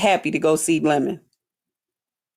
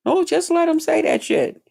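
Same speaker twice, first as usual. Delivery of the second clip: ordinary talk, nothing marked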